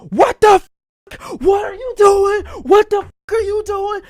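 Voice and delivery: high shrieking outrage; high, loud scandalised shriek; sisters yelling. Retold or imitated. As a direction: yell at him like you caught him.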